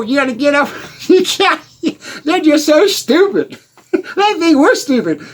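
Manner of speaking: high laughing ridicule, words breaking up; cackling at the dumb drivers. Real, not acted. Mocking the fools at the light.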